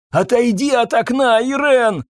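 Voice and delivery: high, urgent cry; frightened husband's warning; panicked warning. A narrator's reading. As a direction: call out in alarm, pitch way up. Husband panics, waving at wife.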